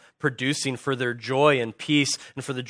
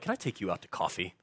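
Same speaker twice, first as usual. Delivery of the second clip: low, soft, loaded invitation; mock kindly; 7 semitones lower, 11 dB quieter than usual. Confronter inviting someone out to coffee, deceptively kindly. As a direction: drop it low and gentle, with confrontation behind it. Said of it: "like, maybe kindly".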